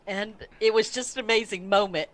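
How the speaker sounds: normal talking voice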